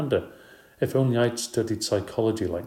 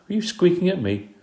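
indignant; 10 semitones higher, 4 dB louder than usual. A narrator's reading. Annoyed stepsister confronts pet.

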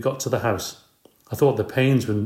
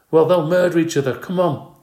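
alarmed; 7 semitones higher, 5 dB louder than usual. A narrator's reading. Mandy alarmed about twins.

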